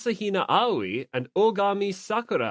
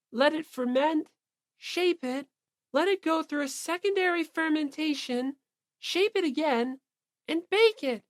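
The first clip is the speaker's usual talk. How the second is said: gentle Fujisaki; 11 semitones higher than usual. Acted. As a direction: explain it sweetly, step by step. Fujisaki explaining baking steps.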